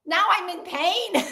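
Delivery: high-pitched mock hysterical wail; hammed-up cry of pain; Gene Wilder. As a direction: imitate a film character in hysterics. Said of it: "he slaps him and he goes".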